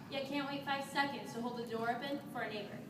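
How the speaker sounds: ordinary delivery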